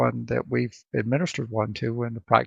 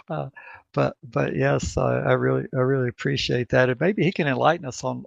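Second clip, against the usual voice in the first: normal talking voice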